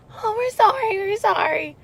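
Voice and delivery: high, singsong fake apology; mock sheepish roommates. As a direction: mimic the roommates' giggly sorry.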